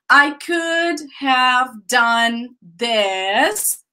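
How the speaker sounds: slow, careful demo of could have. Shown as a demonstration. Teacher modelling the phrase slowly for students. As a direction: spell out each word slowly for the class. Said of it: "talk about the pronunciation here".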